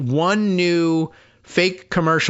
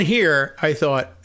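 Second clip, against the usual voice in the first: usual speaking voice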